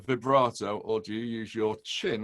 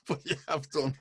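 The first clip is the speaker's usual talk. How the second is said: ordinary talk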